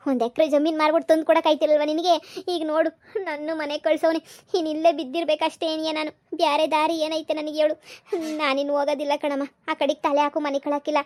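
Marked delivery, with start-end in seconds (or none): none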